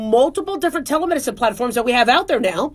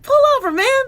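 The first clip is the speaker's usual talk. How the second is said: high-pitched, incredulous call-out; retold in a high, urgent pitch; alarmed retort; 12 semitones higher, 3 dB louder than usual. Doctor telling a driving patient to pull over, alarmed.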